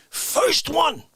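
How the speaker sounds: ordinary talk, nothing marked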